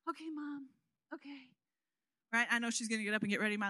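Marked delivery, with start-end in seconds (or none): 0.1-1.5 much softer, groggy okay; sleepy teen reply. Retold or imitated. Teen daughter answering mom's wake-up call, groggy. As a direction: mumble it half asleep.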